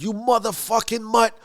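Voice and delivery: high-pitched retold outburst; irate. Tommy enraged by Bats' shine box taunt, irate. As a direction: spit the insult in acted fury. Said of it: "which leads Tommy to be irate".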